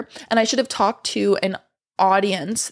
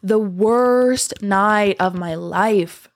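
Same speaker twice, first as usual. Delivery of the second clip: worst night of my life drawn out slowly, worst stressed; slow emphasis; much slower than usual. Real, not acted. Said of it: "are you guys hearing that?".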